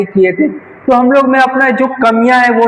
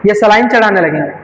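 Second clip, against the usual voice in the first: usual speaking voice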